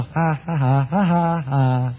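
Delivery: put-on "ha ha" laugh, kept low; mock laugh. Peter turning to companions in the boat, smug mock laughter.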